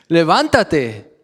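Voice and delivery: high, lively call with a laugh; high amused wake-up call; Jesus waking him. Retold or imitated. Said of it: "le dijo".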